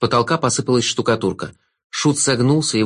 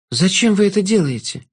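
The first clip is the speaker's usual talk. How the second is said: put-on higher, earnest girl's voice; a brave girl standing her ground; higher girl's voice confronting the jester; young girl Alex; 8 semitones higher than usual. A narrator's reading. Girl asks.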